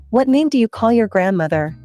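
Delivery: usual speaking voice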